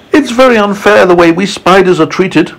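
high-pitched, complaining spider voice; high, hard-done-by character; aggrieved Miss Spider. Acted. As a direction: protest the injustice as a storybook spider. Spider complains of unfair treatment.

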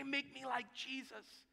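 soft, slow, hushed correction; gentle correction. Retold or imitated. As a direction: quietly coach him. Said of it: "You need to say".

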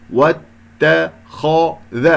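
"واتخذ" in slow separate syllables, thin ت and ذ round a full خ; heavy خ only. Shown as a demonstration. Teacher isolating the خ between light letters, slow syllabled "واتخذ". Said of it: "الخاء هنا انحصرت بين المرققين".

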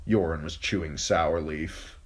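ordinary delivery

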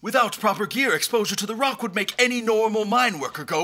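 high, fast, rushing worry; the words tumble out in alarm. Acted. Doctor warning the king about the rock, alarmed and rushed.

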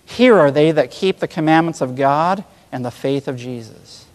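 usual speaking voice

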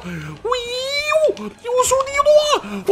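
high crying wail; mock wail. Gamer wailing as his fighter takes hits, high mock cry. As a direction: whimper like a beaten fighter. Wailing while getting hit.

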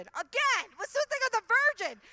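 high, loud disbelieving outburst; high, loud exasperated what's-with-that; incredulous aside. Real, not acted.

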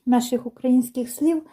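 ordinary delivery

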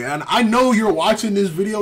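ordinary delivery

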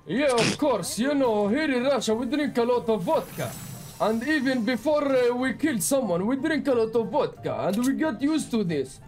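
thick put-on Russian accent, broken English; joking Russian accent; fake Russian accent. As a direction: play a boastful Russian gamer in a heavy Russian accent.

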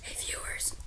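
secret whisper to the viewers; hushed aside to the audience. Acted. Gamer whispering a secret hello to his viewers, breathy whisper.

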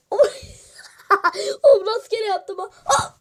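ordinary delivery

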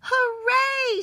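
the friends cheering together at the pond; shouted hooray. Acted. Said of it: "shouted ... all together".

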